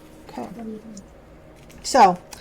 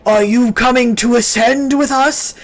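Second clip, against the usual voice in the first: half-orc cultist; 4 semitones higher, 4 dB louder than usual. Acted.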